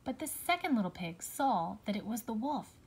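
ordinary delivery

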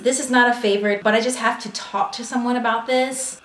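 normal talking voice

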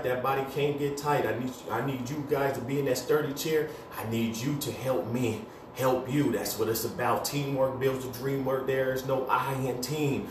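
normal talking voice

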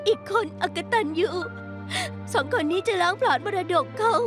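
distraught, catching breath between phrases; weeping, broken into short gasped phrases; tearful recounting. Acted. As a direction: plead through tears after a nightmare. Tearfully recounting nightmare of dead father.

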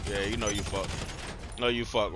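ordinary talk

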